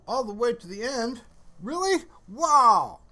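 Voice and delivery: ordinary talk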